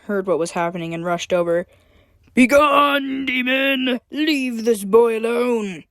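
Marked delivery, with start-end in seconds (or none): 2.3-5.9 commanding warthog knight voice; snorting warrior. Acted. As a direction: bellow it like a brave beast. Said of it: "he snorted".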